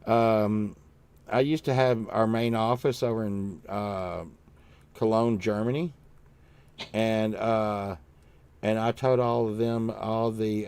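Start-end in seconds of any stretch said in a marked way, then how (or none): none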